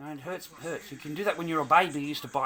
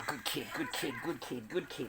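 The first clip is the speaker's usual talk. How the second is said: usual speaking voice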